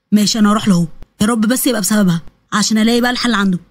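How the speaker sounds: low male character voice; hope for a fix, voice kept low; hopeful young man. Acted. (شاب بيتمنى حل بصوت واطي)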